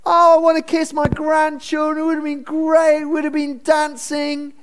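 an oily uncle faking fondness; mock sweetness. Mocking Laban's sweet words.

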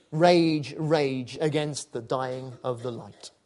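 slow, fervent poetry reading; poem recitation. Acted. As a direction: declaim the poem with rising passion. Recites Dylan Thomas poem.

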